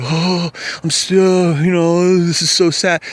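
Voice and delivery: high, slow whiny fake sorrow; hammed-up crocodile tears; mock fake crying. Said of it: "fake crying".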